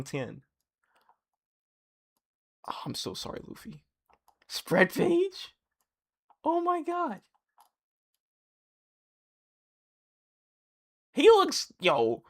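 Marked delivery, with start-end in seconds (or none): 2.7-3.8 hushed whisper, barely voiced. Real, not acted. Reader of the manga panel, hushed whisper. Reading manga line under breath. 4.5-11.7 much higher, gasping at the page; real thrill at the art; excited. Real, not acted. Reader seeing a spread page, high excited gasp.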